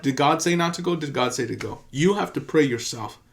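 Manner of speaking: usual speaking voice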